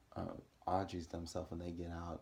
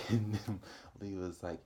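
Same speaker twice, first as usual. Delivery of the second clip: ordinary talk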